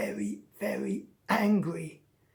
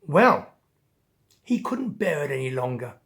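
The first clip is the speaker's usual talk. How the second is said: plain reading voice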